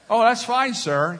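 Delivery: high, loud, obliging agent voice; approving airline agent. Retold or imitated. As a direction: imitate the agent heartily waving him through. Agent approves the borrowed shirt.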